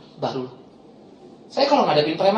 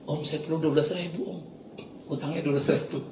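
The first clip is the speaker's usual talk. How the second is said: sheepish request; 3 semitones lower, 10 dB quieter than usual. Retold or imitated. Thug asks for money.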